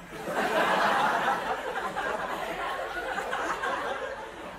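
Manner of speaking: long breathy falling laugh, very high; high unvoiced cackle fading down; high airy laugh. Real, not acted. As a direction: burst out laughing at the punchline. Laughs at punchline, never took class.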